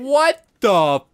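drawn-out, loud "what the fuck"; loud disbelief. Real, not acted. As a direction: exclaim in outraged disbelief, word by word. Reacts to bad hit.

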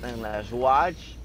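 slow savoring. Real, not acted. Savoring the strike.

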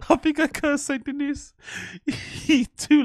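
high, laughing through the words. Real, not acted. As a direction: giggle the line out. Laughing at his own jinx.

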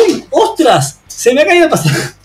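high-pitched mock alarm; sorpresa actuada. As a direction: exclaim it with exaggerated surprise. Acted discovery of dropped passport. (sorpresa aguda y exagerada)

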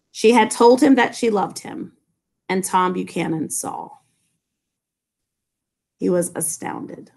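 measured, weighty reading; story narration. A narrator's reading. Teacher reads key lines.

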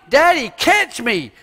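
put-on high, loud little child's call; catch-me call of a small kid; high childlike plea. Small child asking daddy to catch him, high and loud. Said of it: "little child's word".

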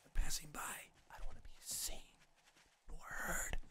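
hushed whisper to avoid being heard. Real, not acted. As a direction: whisper as if hiding from passing zombies. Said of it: "So that's why I'm whispering".

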